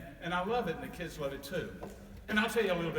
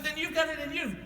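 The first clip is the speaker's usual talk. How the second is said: eager insistence; 11 semitones higher, 4 dB louder than usual. Acted. Santa presses the snowman.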